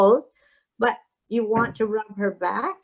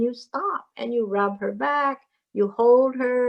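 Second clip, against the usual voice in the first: normal talking voice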